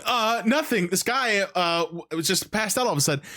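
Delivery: high, fast guilty excuse; nervous Lola. Retold or imitated. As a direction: bluff your way out quickly. Lola lies about the body.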